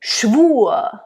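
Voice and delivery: Schwur with the r softened to a schwa; German schwa ending. Shown as a demonstration. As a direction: say Schwur as "shvoo-uh", no rolled r. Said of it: "we kind of go to a schwa".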